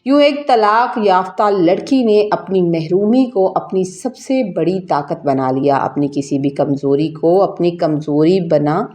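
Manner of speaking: plain reading voice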